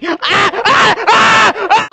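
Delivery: repeated high screams; high panicked shrieks; screams of terror. Acted. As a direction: scream as if being killed. Thinks they will kill him.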